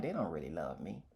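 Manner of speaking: hushed, sinking thought to himself; hurt inner voice. Retold or imitated. A man admitting his private fear, hushed. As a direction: barely voice the fear, low and soft. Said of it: "I'm like".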